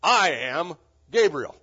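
"I am" slow and very loud, then "Gabriel" set apart; emphatic I AM. Acted. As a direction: hit "I am" hard before naming Gabriel. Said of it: "The same emphasis".

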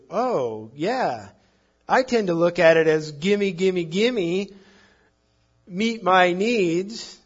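loud, whiny gimme gimme, slowing on meet my needs; mock greedy demand. Preacher imitating believers who treat God as a vending machine, mock greedy whine. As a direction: ham up a greedy child grabbing.

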